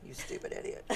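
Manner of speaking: low-volume, voiceless aside; hushed mock self-insult; muttered mock self-reproach. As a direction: scold yourself under your breath. Scolds herself after mock singing.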